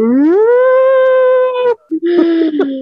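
long, high-pitched imitated bawl; mock crying wail. Daughter breaking down before her mother, mock bawling. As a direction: burst into an exaggerated held wail. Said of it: "comecei a chorar na rua".

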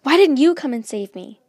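loud, high angry accusation; furious. A narrator's reading. Luna turns on Polexia for not saving her, furious. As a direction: shout the question as a furious reproach. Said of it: "She was furious".

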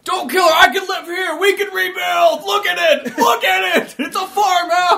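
high, loud, slow mock-desperate begging; hammed-up pleading. Pretend henchman begging the boss to spare her, high hammy shouting. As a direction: plead over the top like a sitcom wreck.

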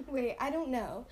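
normal talking voice

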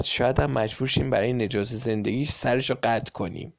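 plain reading voice

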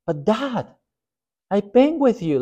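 high-pitched whining son; whiny son. Retold or imitated. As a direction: whine like a spoiled grown son. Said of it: "What is the second son say to the father?".

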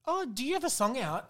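high-pitched mock surprise; fake interest. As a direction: feign polite interest, way up high. Mimicking fake-interested industry people.

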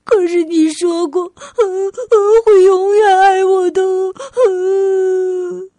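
high, loud wailing protest; acted sobbing plea to Mum; crying child. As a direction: bawl through the words like a little girl. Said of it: "阿丽哭着说".

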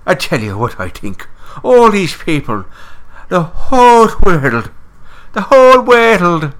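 hammed-up Dublin brogue; Irish accent. Podcaster hams up a full-blown Irish accent for her co-host. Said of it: "full-blown Irish accent".

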